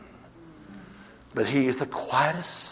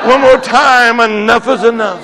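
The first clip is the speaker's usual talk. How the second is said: louder, higher call to the crowd; preaching shout; 8 semitones higher, 13 dB louder than usual. Acted. Preacher leading the church in the cry, loudly. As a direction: drive it home once more.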